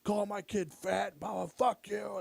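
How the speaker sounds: playful impression of a drunk dad swearing at staff; drunk dad rant. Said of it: "he's been fucking drinking and he's like".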